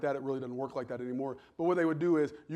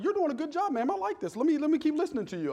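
much higher teasing voice; playful mock praise; 11 semitones higher, 3 dB louder than usual. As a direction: hand it back with a grin. Teasing quote to lady on plane.

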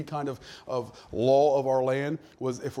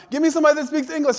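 fast, loud, higher grandma outburst; a cranky grandma snapping at the phone; impatient old lady, loud and quick; grandma's demand; 10 semitones higher, 6 dB louder, faster than usual. Retold or imitated. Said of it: "I heard my grandma ... say".

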